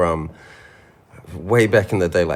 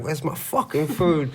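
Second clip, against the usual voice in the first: retold scream; 8 semitones higher, 3 dB quieter than usual. Drunk friend retelling the door-booting, mock screaming. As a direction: imitate yourself screaming for food without full volume. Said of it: "just screaming at them".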